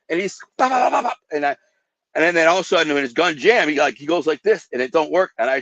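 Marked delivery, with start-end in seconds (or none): 0.6-1.2 high quick pops; mock gunfire pops. Recreating the shooter's gunfire for the hosts, high mock pops. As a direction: rattle off shots like a pistol. Imitating shots.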